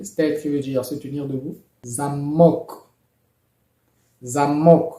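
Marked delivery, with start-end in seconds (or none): 1.8-2.7 "Zamok" with the second syllable stressed, louder. Shown as a demonstration. Teacher models the Russian word for lock, "Zamok" stressed on "mok". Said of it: "l'accent tonique est posé sur la deuxième syllabe". 4.2-4.9 "Zamok" with a louder, stressed "mok"; stress on second syllable. Shown as a demonstration. Teacher repeats the example for learners, "Zamok" with final-syllable stress. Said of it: "l'accent tonique est posé sur la deuxième syllabe".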